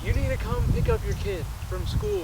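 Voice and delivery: high-pitched put-on woman's voice; wife's voice. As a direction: play the estranged wife on the phone. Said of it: "She didn't sound like that".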